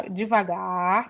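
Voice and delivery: stretched out, very slow; slow demo. Shown as a demonstration. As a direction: show how slow it should sound. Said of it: "tem que tentar falar deva". (devagar bem arrastado, lento)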